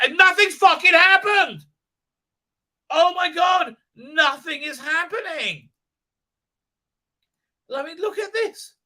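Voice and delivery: high, loud exasperated outburst; exasperated shout. Real, not acted. Reviewer shouting at the show in disbelief. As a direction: shriek in disbelief at the screen.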